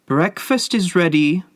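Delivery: high, singsong calling out; cheery summons, pitched well up; bright call. A narrator's reading. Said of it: "she called brightly".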